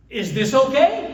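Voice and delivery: a husband checking in with his wife, loudly pointed; mock assertive ask. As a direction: put the question like you're the boss. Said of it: "That's like saying, "Woman, I'm in charge here".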